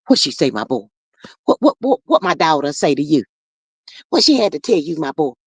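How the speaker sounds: his mom. Retold or imitated. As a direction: slide down low like a doting mother asking her son. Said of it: "you got his mom down pat".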